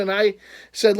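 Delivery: ordinary delivery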